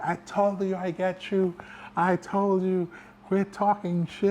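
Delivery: high, insistent imitation of a winner; gloating buddy. Chris coming over after choking him out, gloating.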